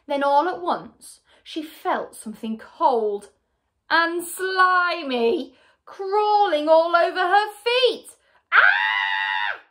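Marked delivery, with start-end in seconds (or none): 3.9-8.1 slow, high, loud storyteller's dread; mounting horror. A narrator's reading. Narrator builds up to the scream. 8.5-9.6 loud story-character shriek of fright; terrified scream. A narrator's reading. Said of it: "she screamed".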